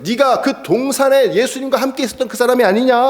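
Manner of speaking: high, challenging accusation; Malchus's relative confronting Peter; accusing relative. Acted.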